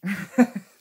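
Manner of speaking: a quick bright laugh after finally getting the word out; relieved laugh. Real, not acted. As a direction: laugh at your own tongue-twister. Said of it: "j'ai réussi à le dire".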